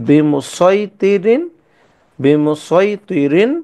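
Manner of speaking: বিমুসাইতিরিন stretched slowly; slow recitation. Shown as a demonstration. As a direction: read বিমুসাইতিরিন syllable by syllable. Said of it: "সঠিক উচ্চারণ".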